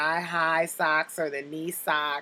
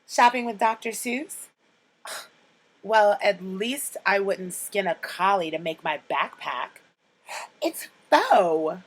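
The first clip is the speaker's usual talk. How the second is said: quoting Cher; 4 semitones higher, 5 dB louder than usual. Retold or imitated. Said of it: "my top three quotes from Clueless".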